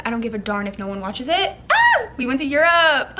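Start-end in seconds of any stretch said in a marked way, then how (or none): 1.7-2.1 high, loud squeal of joy; excited scream. Real, not acted. Excited about Europe trip.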